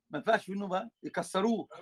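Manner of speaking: ordinary talk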